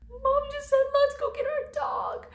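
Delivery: very high, loud imitation of an overjoyed squeal; excited squeal. Telling Natalie they're getting the dog, high excited squeal. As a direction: squeal the news in mock high-pitched excitement. Breaking the big news to sister.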